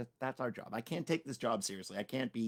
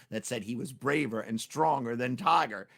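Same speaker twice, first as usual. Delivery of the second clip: ordinary delivery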